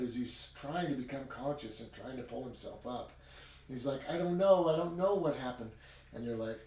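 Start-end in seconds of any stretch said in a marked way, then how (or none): 4.1-5.7 high, louder, flustered protest; retold panicky answer. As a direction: imitate someone overwhelmed by the questioning. Retold dazed victim answering frantic questioner.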